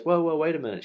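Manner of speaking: high, alarmed whoa, imitating the wife; a mock-startled hold on; startled protest. Wife cutting off the angry operator, retold with startled alarm.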